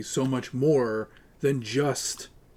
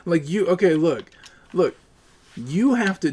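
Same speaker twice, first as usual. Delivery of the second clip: louder, higher, exasperated; annoyed raised voice; 6 semitones higher, 6 dB louder than usual. Real, not acted. Gamer snapping at a chat heckler, raised voice. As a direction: cut the chat off sharply.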